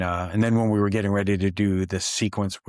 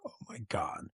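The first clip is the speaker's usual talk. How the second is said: very soft, awed murmur; quietly stunned by the sight; hushed awe; 12 dB quieter than usual. Retold or imitated. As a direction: marvel under your breath. Retold awe walking onto the set.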